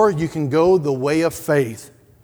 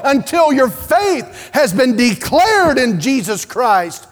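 loud, high, driving preacher's cry; fiery preaching; 5 semitones higher, 4 dB louder than usual. Acted. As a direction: preach it out with full force to the congregation. Preaching at full force.

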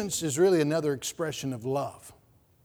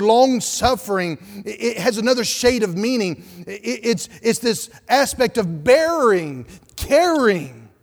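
louder, higher preaching push; fervent preaching; 4 semitones higher, 7 dB louder than usual. Acted. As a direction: preach it out with fire to the congregation. Preaching at full force.

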